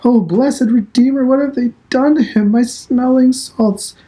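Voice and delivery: high, loud panicked cry; shrill alarm. A narrator's reading. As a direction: shriek for the smelling salts. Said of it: "cries out in shrill alarm".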